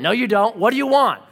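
loud, high, acted contradiction; mock hostile outburst; irritated demand. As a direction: cut the visitor off as the mean neighbor. Mean neighbor rejects excuse.

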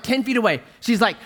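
ordinary talk, nothing marked

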